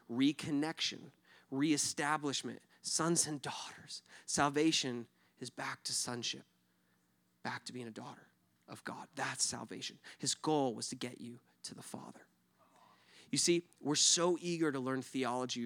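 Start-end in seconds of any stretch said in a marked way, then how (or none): 2.8-12.2 hushed, breathy whisper to the congregation. Real, not acted. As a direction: drop to a near whisper, intimate. Pastor softens into intimate teaching.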